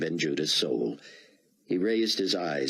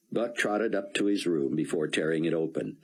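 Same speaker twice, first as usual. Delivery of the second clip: ordinary delivery, nothing marked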